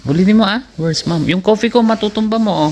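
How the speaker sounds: high-pitched parent talk. Real, not acted. Parent prompting toddler to repeat.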